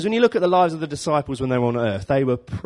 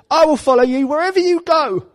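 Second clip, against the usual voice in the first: much higher, earnest, keen promise; a would-be disciple pledging himself; eager follower; 6 dB louder than usual. Acted. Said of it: "a man said to him".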